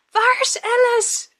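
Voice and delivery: high, drawn-out yell for the midwife; high scream. Retold or imitated. Mother in labour screaming for the midwife, high loud yell. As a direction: shriek it out in the middle of labour. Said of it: "I screamed in between".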